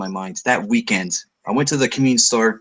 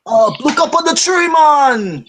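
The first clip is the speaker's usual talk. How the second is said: high, loud, hammed-up fake Jamaican villain; mock Jamaican accent; 6 dB louder than usual. Joking about a comic villain's awful accent, high loud mock Jamaican. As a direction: yell it in an over-the-top bad Jamaican accent. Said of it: "how anyone from Jamaica's ever spoken".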